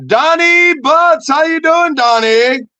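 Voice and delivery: loud, high, drawn-out holler; shouted greeting stretched long; calling out. Pretend. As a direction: holler the name like a hype man. Calling out listener's name.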